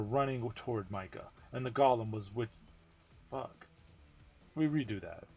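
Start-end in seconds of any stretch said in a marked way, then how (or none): none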